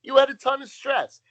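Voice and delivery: high, loud indignant interruption; mock-outraged butting in; Nat. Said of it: "Nat interrupts her".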